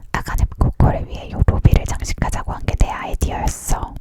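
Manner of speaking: plain reading voice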